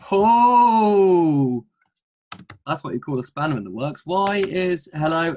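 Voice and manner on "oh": loud, high, long-held "ohhh"; big surprised "ohhh". Real, not acted. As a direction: let out a huge drawn-out "ohhh" that falls at the end. Reacts to surprise right-back pick.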